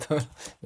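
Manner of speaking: quick laugh at your own example. Real, not acted.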